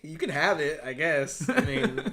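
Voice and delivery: loud, mock-wounded concession; grudging surrender. Joking as someone whose song was stolen, grudging surrender. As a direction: give it up loudly and grudgingly. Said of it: "You're just like".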